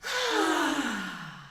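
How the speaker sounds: long audible sigh out; big sigh. Shown as a demonstration. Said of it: "Breathe in".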